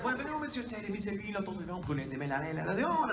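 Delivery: high, lilting Quebecois French welcome. Retold or imitated. Handler welcoming a guest to Montreal, lilting Quebecois French. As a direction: imitate a Quebecois handler's greeting. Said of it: "she had this gorgeous Quebecois accent".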